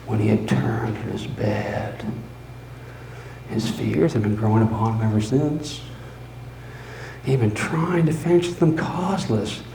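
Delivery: plain reading voice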